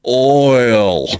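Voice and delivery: high, loud "Oil" that strains and still comes out Southern; a husband straining hard to say "Oil" properly; struggling Southern oil. Retold or imitated. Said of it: "you could see him struggling".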